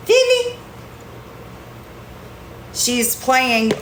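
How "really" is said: high sing-song pet voice. Real, not acted. Cooing to the cat.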